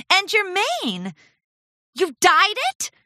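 high, slow stunned question; aghast young pony; shocked filly. A narrator's reading. Charity shocked.

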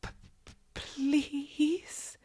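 stuttering, high, soft plea; hesitant. A narrator's reading. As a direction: stammer softly up to a timid questioning "please". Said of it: "you say hesitantly".

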